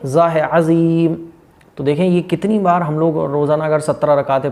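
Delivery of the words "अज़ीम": careful अज़ीम; slow, correct ज़. Shown as a demonstration. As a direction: draw out अज़ीम, sounding the ज़. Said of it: "अजीम नहीं है".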